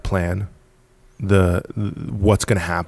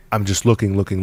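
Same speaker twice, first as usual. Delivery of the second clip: ordinary talk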